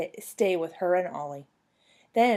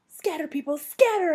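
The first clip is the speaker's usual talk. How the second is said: high yell building louder; 9 semitones higher, 5 dB louder than usual. A narrator's reading. Child diving behind bush warning everyone, yelling. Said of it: "I yelled".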